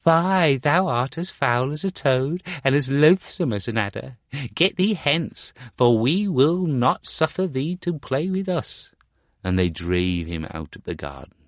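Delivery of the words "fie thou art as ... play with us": higher, louder jeering; mocking children. Playmates jeer at the ugly Star Child, mocking. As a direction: taunt him out of the game. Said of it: "they mocked him and said".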